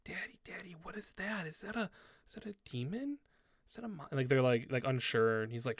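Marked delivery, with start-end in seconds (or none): 0.1-4.1 put-on high, hushed kid whisper; little kid's stage whisper; child whispering. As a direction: whisper anxiously to daddy like a small child. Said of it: "like, whispering".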